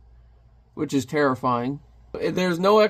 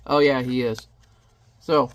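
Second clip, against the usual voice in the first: ordinary talk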